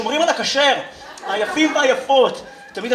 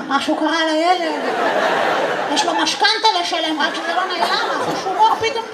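gossiping relatives; 6 semitones higher than usual. Retold or imitated. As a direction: fret in a high voice behind someone's back. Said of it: "מרכלים על האמא שלי מאחורה". (חיקוי רכלנים בקול גבוה)

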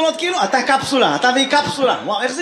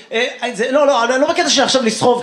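ordinary delivery

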